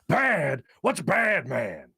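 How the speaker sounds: loose, lightly voiced Pacino growl; a quick stab at the Scent of a Woman Pacino; Al Pacino impression. Host trying Pacino for the chat, lightly voiced. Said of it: "That's even terrible".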